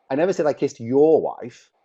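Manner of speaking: loud, high emphatic read ending hard on "wife"; stress on wife. Shown as a demonstration. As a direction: punch "wife" loud at the end. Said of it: "I can emphasize with my voice".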